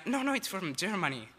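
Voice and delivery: high, loud contradiction; mock insistent correction. Exchange student mimics a pupil correcting classmate, mock insistence. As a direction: imitate a kid butting in to correct.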